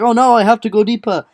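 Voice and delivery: ordinary talk, nothing marked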